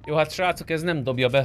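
usual speaking voice

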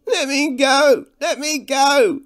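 high, drawn-out desperate shout; loud cry. A narrator's reading. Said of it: "a much louder cry rang out".

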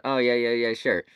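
normal talking voice

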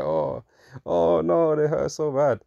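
high, whiny mock victim; mock them as a sobbing victim; mock victim whine. Mocking Israel as a crybaby victim, high whiny mock cry. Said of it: "you sit there posturing like".

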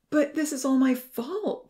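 high, drawn-out, upset wail; crying out. A narrator's reading. Ponzi takes the blame, crying out. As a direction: cry it out slowly in guilt. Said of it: "Ponzi cried".

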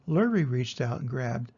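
usual speaking voice

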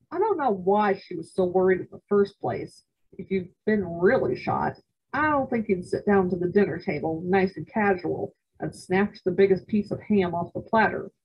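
usual speaking voice

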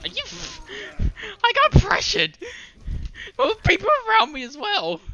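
high, loud, frantic protest; panicked yelling. Real, not acted. Gamer ambushed by enemies, yelling in outrage. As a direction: yell it out in real panic.

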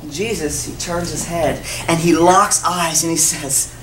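usual speaking voice

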